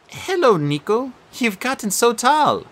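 put-on calm her old friend's guilt; gentle girl ghost. Bianca's voice.

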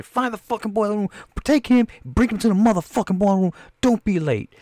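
high, barking mock boss; a prison boss snapping orders; imitating the warden. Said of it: "He was like".

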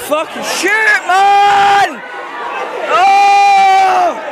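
loud high scream at the players; yelling from the sidelines. Real, not acted. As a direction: bellow for a shot.

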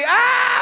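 high, loud, sustained scream; acted scream. Comedian re-enacting being attacked on stage, high scream. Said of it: "Y yo estaba así".

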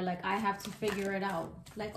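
normal talking voice

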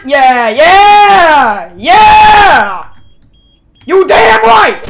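loud, drawn-out yells; triumphant shouting. Real, not acted. Streamer celebrating a hit in the game, triumphant shouting. Cheering own move in game.

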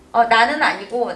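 ordinary delivery

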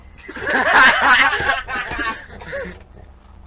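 bursting into high loud laughter. Real, not acted. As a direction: crack up helplessly. Narrator cracks up at own line.

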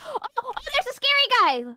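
high gasping alarm; playful fright at a monster; mock alarm. Spotting a monster.

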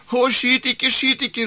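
high, loud panicked cry; frantic shout. Acted. Wife begs husband aiming the rifle, frantic shout. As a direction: shriek to stop the shot. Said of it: "ropte kona".

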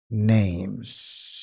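"Names" with a long hissed S at the end; stretched closing S on "Names"; final S pronounced. Shown as a demonstration. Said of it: "Tiene que pronunciarle esa S al final".

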